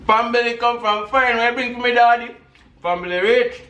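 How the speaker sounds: loud and high, playing it up; too loud. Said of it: "too loud".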